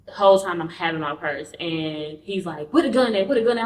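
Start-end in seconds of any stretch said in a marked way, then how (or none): 2.7-3.6 higher, louder repeated demand; pressing, insistent questioning; retold urgent demand. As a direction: imitate an attacker hunting for a weapon. Retold attacker demanding the gun.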